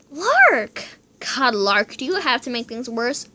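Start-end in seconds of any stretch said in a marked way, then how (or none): 0.1-0.8 gasp, then cry out the name high and loud; shocked outburst at her sister; scandalised yell of a name. Acted. Horrified at sister's words.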